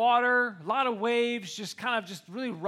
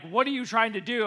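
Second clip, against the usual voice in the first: normal talking voice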